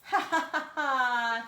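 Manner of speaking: loud, high screaming laugh; giant's scream laugh. Acted. Greedy giant counting gold, screaming laugh. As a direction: cackle at the gold at full volume. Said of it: "he screamed".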